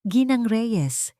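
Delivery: slow and grave; serious. A narrator's reading. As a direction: weigh each word seriously. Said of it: "seryosong sabi ni Alab". (seryoso at mabagal)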